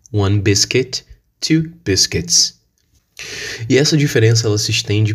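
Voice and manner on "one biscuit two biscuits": two biscuits in a light British accent. Shown as a demonstration. Teacher models British biscuits for students. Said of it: "no British English".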